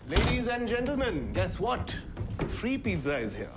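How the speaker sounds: high-pitched showman's announcement; grand announcement. Acted. Host announces free pizza to guests, grand announcement. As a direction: proclaim it like a master of ceremonies.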